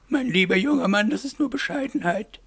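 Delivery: put-on high lady's voice addressing a young man; patronising mademoiselle. A narrator's reading. Aglaé corrects her dear young man, high patronising lady voice. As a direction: correct him in a high character voice.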